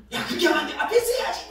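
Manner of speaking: high, loud armchair-coach shouting; yelling at the football. Retold or imitated. Pastor watching football on TV, yelling at players. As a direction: holler instructions at the players on TV. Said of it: "소리를 내면서 축구를 보거든요".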